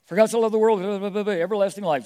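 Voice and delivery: high, loud rushed recitation; eager kid reciting. Retold or imitated. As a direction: rattle off the verse like an eager child. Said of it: "He goes".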